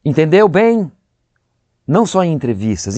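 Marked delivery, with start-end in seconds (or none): 0.0-0.9 imitating Fernanda's loud, higher finishing tag; hammed-up theatrical check-in at the end; mock diva. Teasing actor imitates Fernanda asking if understood, loud and high. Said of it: "Mesmo em cena".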